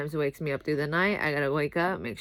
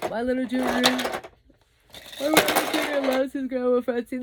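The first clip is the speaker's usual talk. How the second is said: pitched up high and a little louder, doting; 6 semitones higher, 4 dB louder than usual. Real, not acted. Mother doting on her son to the camera, high cooing voice.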